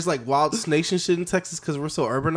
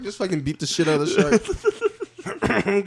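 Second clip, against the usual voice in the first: ordinary delivery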